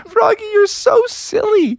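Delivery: high-pitched teasing after a giggle; laugh, then tease in a squeaky voice; amused friends, voice way up high. A narrator's reading. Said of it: "they're all laughed".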